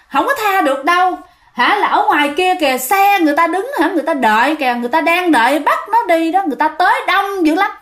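high, insistent refusal; stubborn spirit. Retold or imitated. Spirit refuses neighbor's plea, high insistent voice. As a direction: refuse flatly as a spirit who won't let go. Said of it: "nói tiếp nha".

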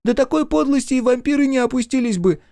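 very high, squeaky outburst; exclaiming. A narrator's reading. Small friend condemns the villain's meanness, exclaiming. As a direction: pipe up in a shrill-pitched outcry. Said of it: "воскликнул Стрекотун".

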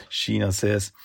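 ordinary talk, nothing marked

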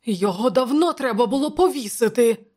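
high, loud indignation; angry. A narrator's reading. Counsellor about the treacherous poet, angry. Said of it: "мовив Публій сердито".

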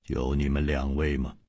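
low, soft, icy question; cold disdain. A narrator's reading. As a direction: ask quietly, coldly belittling them. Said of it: "冷冷地说". (冷冷地低声问)

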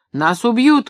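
high, loud warning cry; shouted. A narrator's reading. Funk warning Maxim they will be killed, shouting. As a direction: cry out in alarm. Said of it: "крикнул он".

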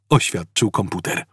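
ordinary delivery, nothing marked

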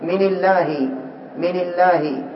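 من الله said "min illahi", with a kasra on the nun and a thin, light lam in الله; thin-lam "min illahi" shown as the mistake; wrong kasra reading. Shown as a demonstration. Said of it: "لو حركت بالكسر ماذا سيقال".

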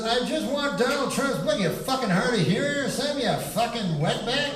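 snapping at the shopkeeper, mock rude; rude customer. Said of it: "He goes".

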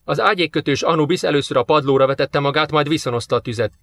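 plain reading voice

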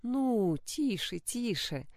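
gentle hushing, softening to a hush; soothing a child. Acted. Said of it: "ласково успокаивала сына королева".

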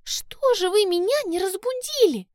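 put-on high, fussy fairy girl voice; cartoon princess. A narrator's reading. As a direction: play the pampered fairy, dismayed.

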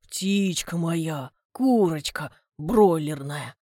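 put-on a suitor cooing to his girlfriend, low and slow. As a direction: croon the pet names.

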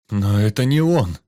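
startled fear in the voice; frightened. A narrator's reading. Vanya realising the voice is wrong, scared. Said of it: "испуганно сказал Ваня".

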